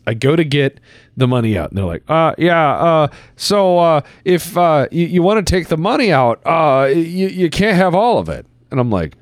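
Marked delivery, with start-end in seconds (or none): 2.1-8.5 higher, stammering advisor voice; hesitant advisor voice. Retold or imitated. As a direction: play the nervous advisor. Quoting a hesitant fund manager.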